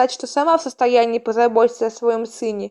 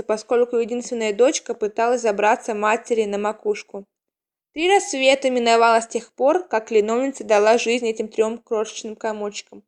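usual speaking voice

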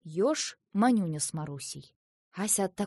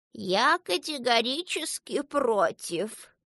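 slow, emphatic, higher refusal; firm objection; 4 semitones higher, 4 dB louder, slower than usual. A narrator's reading. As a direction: object weightily, leaning on each word. Vasilisa flatly refuses.

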